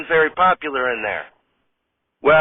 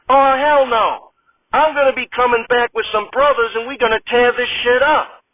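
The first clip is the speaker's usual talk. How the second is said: hell no, then vow revenge; furious delivery woman storming out; outraged refusal; 7 semitones higher than usual. Acted. Vance outraged, threatens.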